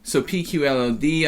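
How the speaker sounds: ordinary delivery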